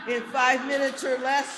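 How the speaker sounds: "five" punched in a loud shout; shouted repeat. Real, not acted. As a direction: bellow it out to the crowd for applause.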